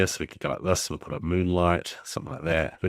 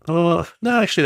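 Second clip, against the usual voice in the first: a sudden, much higher oh no; 12 semitones higher, 5 dB louder than usual. Real, not acted. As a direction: catch a mistake mid-task, jump up in pitch. Spots a mistake in the scene.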